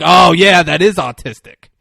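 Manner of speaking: loud mock agreement; sarcastic agreement. Mocking the friend's idea in fake agreement. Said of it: "as if I'm gonna be like".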